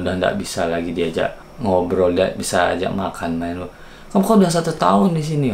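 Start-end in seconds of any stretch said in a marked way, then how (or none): 4.1-5.5 high, fast exasperated scold; mock boss reproach. Boss scolding the employee for repeated mistakes, high quick scold. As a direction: rattle it off like a fed-up boss.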